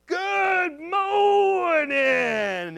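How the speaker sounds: long, high, loud holler; cheery called-out greeting. Retold or imitated. Speaker hails the camp from his porch, hollering. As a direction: sing it out across a yard, stretched. Calls greeting to campers in story.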